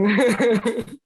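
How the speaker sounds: short, high, loud laugh; rueful laugh. Real, not acted. Guest recalling the fitting before cancelled wedding, laughing loudly. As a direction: burst into a brief high laugh.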